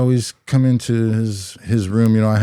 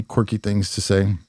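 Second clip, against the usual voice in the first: ordinary talk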